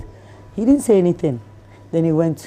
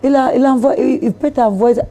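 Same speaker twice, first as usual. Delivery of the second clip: higher, louder confident French; speaking French; 7 semitones higher, 4 dB louder than usual. Retold or imitated. Translating his car deal into French, raised and confident. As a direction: rattle off French like a pro. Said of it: "So I was like".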